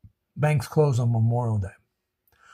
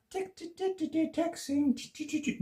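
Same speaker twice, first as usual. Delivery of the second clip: imitated texting clicks; 14 semitones higher, 8 dB quieter than usual. Mimicking texting taps.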